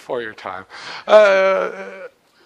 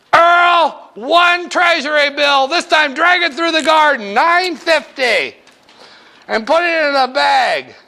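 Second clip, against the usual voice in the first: Mabel calling to Earl; 7 semitones higher than usual. Acted. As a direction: sing out the order to a coworker. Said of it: "I'm Mabel".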